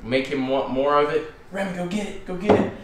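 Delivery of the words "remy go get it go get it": high, eager demo command; excited fetch command. Shown as a demonstration. Trainer demonstrating to viewers the eager fetch cue. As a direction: show how to fire the pup up. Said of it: "We're gonna do this".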